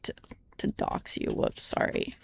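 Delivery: soft whisper, embarrassed; hushed apologetic whisper; whispered aside. Real, not acted. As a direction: breathe it out as a sheepish aside.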